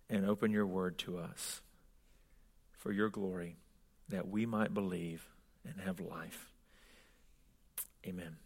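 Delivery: soft, low praying voice with long pauses; prayer. Real, not acted. Preacher asking God to open hearts, quiet prayer. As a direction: pray softly, letting each phrase settle.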